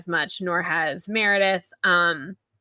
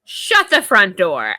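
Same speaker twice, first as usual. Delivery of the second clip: loud, slow exclamation; stunned disbelief; 7 dB louder, slower than usual. Acted. Host stunned that Margaret knows, slow disbelief. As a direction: punch it out slowly in mock shock.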